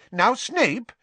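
startled. A narrator's reading. Minister reacting to professor's outburst, startled. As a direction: check a colleague's outburst in surprise.

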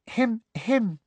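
high, simpering little throat-clear; mock Umbridge's girlish cough; imitating Umbridge. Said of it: "a good imitation of Professor Umbridge".

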